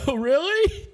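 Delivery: laughing surprised question. Real, not acted. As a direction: laugh through oh really. Amused reaction to anime watching.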